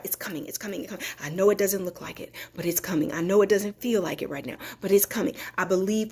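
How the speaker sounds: soft, near-whispered promise; hushed affirmation. Real, not acted. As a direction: murmur it like a private vow to yourself. Soft private affirmation of faith.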